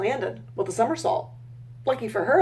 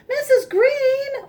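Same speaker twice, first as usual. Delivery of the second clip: high, slow call across water; 4 dB louder, much slower than usual. Acted. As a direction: call out to the teacher. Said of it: "all the little goslings called out".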